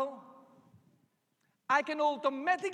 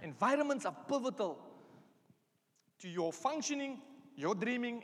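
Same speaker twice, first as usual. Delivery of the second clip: ordinary talk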